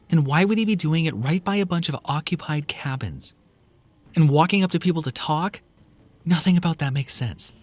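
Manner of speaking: high, loud, exasperated; exasperated woman. Acted. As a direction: push back hard. Kelly arguing.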